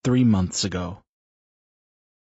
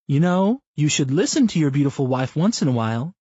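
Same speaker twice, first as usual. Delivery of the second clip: Brenda's voice; 6 semitones higher, 6 dB louder than usual. Acted. Wife teasing her husband mid-run, higher voiced. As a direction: narrator lifts into a higher, lightly voiced wife. Brenda scolds Bob playfully.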